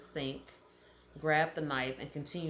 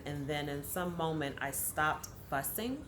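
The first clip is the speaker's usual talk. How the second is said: ordinary talk, nothing marked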